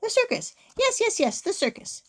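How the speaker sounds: high, excited exclamations; delighted brainwave. A narrator's reading. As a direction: burst out gleefully at your own idea. Witch's delighted idea.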